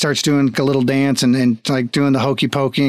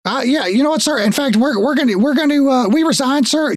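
high-pitched, halting excuse to the boss; flustered guard resigning; 11 semitones higher than usual. Acted. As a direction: stumble nervously through quitting, voice pitched up.

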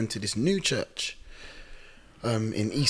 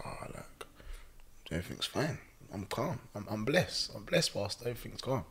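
very soft, playing it down; murmured retold reassurance; quietly dismissive; 2 semitones lower, 16 dB quieter than usual. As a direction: imitate a hushed, evasive reply. Maureen brushing off the pastor, retold.

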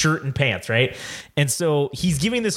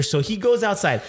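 usual speaking voice

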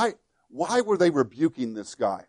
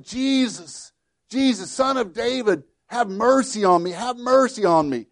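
imitating a desperate yell; 6 semitones higher than usual. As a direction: call out pleading, a retold half-yell. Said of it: "he began yelling".

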